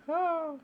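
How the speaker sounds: high sharp gasp of fright; mock frightened gasp. Shown as a demonstration. Teacher acting out a terrified feeling, mock gasp. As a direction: gasp as if scared. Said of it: "I was terrified".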